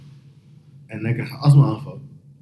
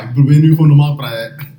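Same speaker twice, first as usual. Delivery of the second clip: ordinary delivery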